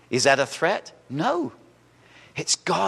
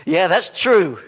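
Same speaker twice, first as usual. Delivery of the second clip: mock agreeing man; 5 semitones higher, 7 dB louder than usual. Men's voice agreeing.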